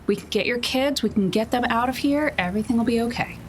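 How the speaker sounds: normal talking voice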